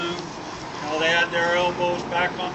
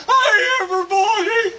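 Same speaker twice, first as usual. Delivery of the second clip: high, frantic yell of warning; alarmed shout; 13 semitones higher, 5 dB louder, slower than usual. Acted. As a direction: holler the alarm to the whole saloon. Old man bursts in with warning.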